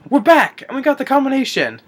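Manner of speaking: high, loud mock triumph; retold triumphant announcement. Henchman reporting success in retold scene, mock triumphant. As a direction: announce it with comic gusto.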